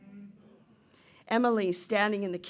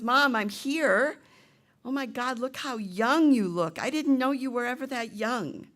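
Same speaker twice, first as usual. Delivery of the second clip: higher, louder wonder; amazed greeting; 5 semitones higher, 5 dB louder than usual. Acted. Ghost daughter sees her mother young again, amazed. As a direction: marvel out loud, drawing the words out at first.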